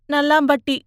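high, timid reply; meek youth. A narrator's reading. Beaten youth naming his village, meek. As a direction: answer small and pitiful.

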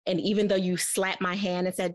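ordinary talk, nothing marked

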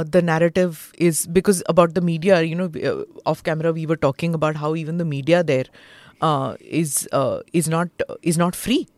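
usual speaking voice

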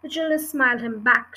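plain reading voice

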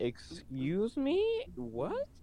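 slow, high drawn-out disbelief; high mock-outraged excuse me; shocked disbelief. Real, not acted. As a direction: stretch it out, appalled. Shocked by an image.

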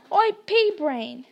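mock-brave yell across the room. A narrator's reading. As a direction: call out to taunt the troll.

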